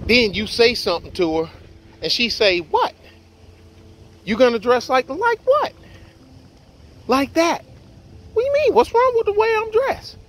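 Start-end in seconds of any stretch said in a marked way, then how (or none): 2.6-7.6 put-on girlfriend voice. Girlfriend quoted, high mocking voice. 8.3-10.2 high, offended woman voice; high indignant girlfriend voice. Retold or imitated.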